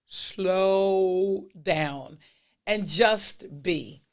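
each word drawn out slowly; slow motion. Shown as a demonstration. Podcast host urging listeners to slow down, in slow motion. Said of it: "I'll say it in slow motion".

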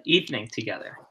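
normal talking voice

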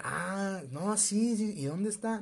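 eager question. Retold or imitated. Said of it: "Yo soy la mamá de tal".

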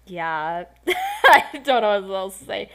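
laughing through the words; giggling embarrassed. Real, not acted. Embarrassed about the story.

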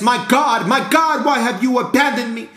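high, loud cry of abandonment; anguished cry. Acted. Jesus crying out to his Father, loud and high.

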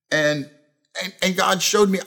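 ordinary talk

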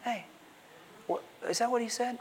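hushed, insinuating tempter; sneaky serpent. Acted. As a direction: sneak the question in under your breath. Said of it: "Very sneaky, sneaking up to us".

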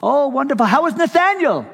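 very high, loud, eager phone greeting; excitable Brother Vic. Retold or imitated. Vic calling the pastor years later, overjoyed. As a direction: greet him like a long-lost friend. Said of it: "excitable kind of guy".

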